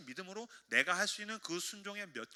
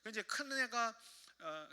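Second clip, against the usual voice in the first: ordinary delivery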